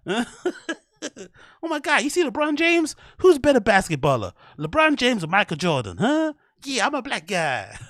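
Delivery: very high, excitable parody voice. Retold or imitated. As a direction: squeal it as an exaggerated send-up. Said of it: "that kind of voice inflection".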